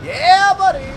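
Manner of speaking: loud, high triumphant yell; excited cheer. Real, not acted. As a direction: whoop it out like a win. Cheering a kill in game.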